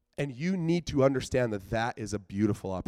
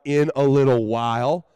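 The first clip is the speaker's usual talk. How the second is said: louder, spitting out the hated phrase; mock-indignant protest; exasperated; 7 dB louder than usual. Shown as a demonstration. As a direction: throw the phrase back with disgust. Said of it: "I hate it".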